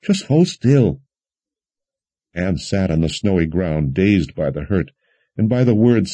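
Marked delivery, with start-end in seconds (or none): 0.0-1.0 high, drawn-out anxious plea; slowly urge, a touch upset; tearful admonishing. A narrator's reading. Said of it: "admonished through her own tears".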